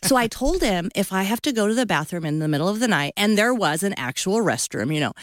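ordinary delivery

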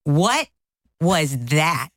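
slow, punched-out indignant questions; incredulous, measured outrage; mad. Retold or imitated. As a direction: reenact an indignant wife, pitch climbing high. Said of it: "I was so mad and embarrassed".

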